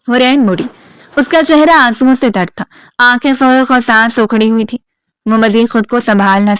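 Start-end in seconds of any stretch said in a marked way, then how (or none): none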